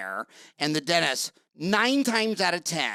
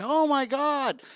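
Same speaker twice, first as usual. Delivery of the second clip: patient's shocked gasp; 8 semitones higher, slower than usual. Retold or imitated. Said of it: "They're like".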